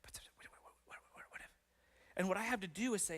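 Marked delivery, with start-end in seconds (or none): 0.0-1.5 hushed, unvoiced inner-voice whisper; whispered nagging. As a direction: whisper like a nagging doubt, stuttering on the words.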